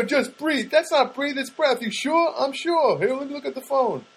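high-pitched mock sitcom back-and-forth; sitcom exchange. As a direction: trade the lines in a high, quick-fire sitcom voice. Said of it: "such a sitcom moment".

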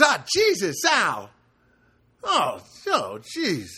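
loud, high jolt of fright, easing off; imitated startled yelp. Neighbor startled by a simple hi, imitated yelp. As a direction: act out jumping out of your skin. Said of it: "he just jumped".

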